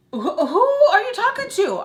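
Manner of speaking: high, loud indignant outburst; outraged yell. Retold or imitated. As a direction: shriek it in mock outrage. Acts outraged response to Sam's outburst.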